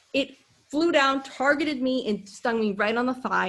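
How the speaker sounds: normal talking voice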